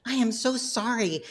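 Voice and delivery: lightly voiced mom gasping an apology; imitating her embarrassed mom; mother's apology. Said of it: "she's like".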